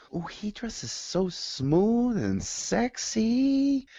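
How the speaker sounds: coworker gushing. Retold or imitated. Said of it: "Not just about the booty".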